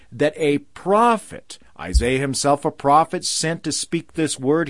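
ordinary delivery

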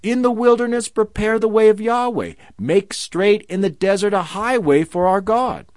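loud prophetic cry. Acted. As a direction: call it out across the wilderness like a herald. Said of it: "voice crying out".

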